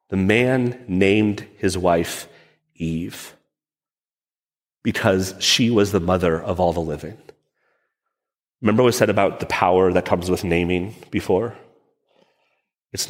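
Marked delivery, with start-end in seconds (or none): 0.1-7.2 sad reading. Shown as a demonstration. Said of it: "the saddest verses in Scripture".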